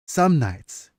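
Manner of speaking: measured storyteller reading; story narration. Acted. Author reading her novel to chat, measured narration. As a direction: read it like an old scribe's memoir.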